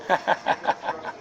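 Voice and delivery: breathy, unvoiced chuckle; laugh. Real, not acted. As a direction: laugh at your own jab. Laughs at own remark.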